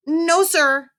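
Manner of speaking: put-on high-pitched young boy pleading; high kid voice. A narrator's reading. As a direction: play the embarrassed kid, voice up high. Kid answers principal.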